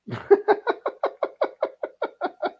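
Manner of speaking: long high airy laugh. Real, not acted. Comedian laughing at the hunched diner, long and high. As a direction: let a long wheezy laugh out.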